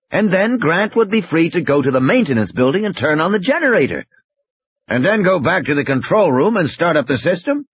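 high-pitched, eager back-and-forth; animated character working out steps; urgent planning. Acted.

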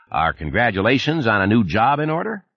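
a chummy Texan supervisor on the phone; Texas twang. Acted. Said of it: "the familiar voice with the Texas twang".

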